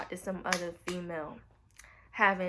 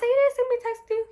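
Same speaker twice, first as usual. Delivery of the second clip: sharp, much higher and louder accusing question; incredulous high demand; 13 semitones higher, 8 dB louder than usual. Retold or imitated. Retelling the confrontation with Jason, a high loud accusing question. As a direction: confront him like you can't believe it.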